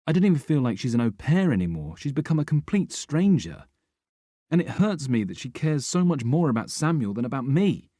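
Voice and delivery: rising, hurt complaint; hurt pleading. A narrator's reading. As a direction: pour out the grievance. Narrator pleads, hurt, to Rick.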